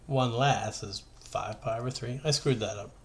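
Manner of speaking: ordinary delivery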